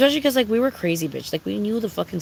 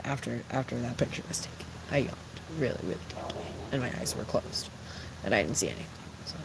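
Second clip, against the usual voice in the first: low, soft near-whisper excuse; sheepish hush; 7 semitones lower, 12 dB quieter, faster than usual. Pretend. Excusing herself for ignoring a shoplifter, low soft hush. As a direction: mumble the alibi like you're guilty. Feigned innocence, dropping to a hush.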